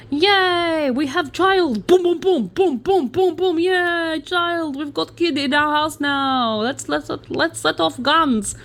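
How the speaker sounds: high, whooping mock Siberian accent; excited villager with a badly faked Siberian accent; terrible Siberian accent. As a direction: celebrate in a hammed-up, bad Siberian accent. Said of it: "terrible Siberian accent speaking English".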